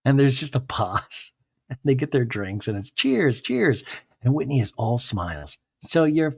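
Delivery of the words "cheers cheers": high chirpy toast, cheers twice; a sing-song fake toast; bright mock cheers. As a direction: clink glasses with fake cheer.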